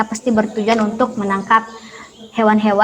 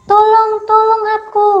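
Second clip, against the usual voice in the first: high, loud desperate cries; trapped lion cries out for help; calling for help; 10 semitones higher, 4 dB louder than usual. Acted. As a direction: shout for help from the trap. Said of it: "singa pun berteriak".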